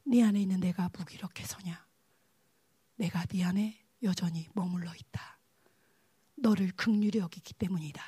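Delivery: low, half-whispered divine voice; God's hushed answer. Retold or imitated. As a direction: answer softly and solemnly as God. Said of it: "저한테 그러시는 거예요".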